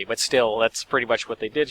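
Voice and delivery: usual speaking voice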